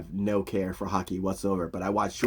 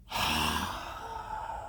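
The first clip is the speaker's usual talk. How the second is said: long, low sigh; imitated sigh; 8 semitones lower, 10 dB quieter than usual. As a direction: let out one long breath as a reaction. Shoresy's reaction, imitated.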